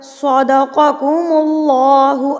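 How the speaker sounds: slow सदक़कुमुल्लाहु with a thick, full l; heavy Allah lam. Shown as a demonstration. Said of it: "अब इसको मोटा पढ़ें".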